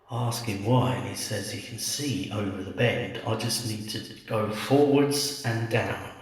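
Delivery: ordinary delivery, nothing marked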